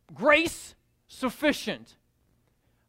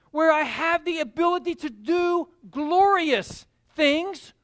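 full-force preaching; 4 semitones higher, 5 dB louder than usual. Acted. Preacher proclaiming power in weakness to the church. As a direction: drive it home to the room.

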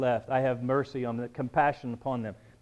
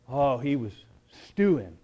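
ordinary talk, nothing marked

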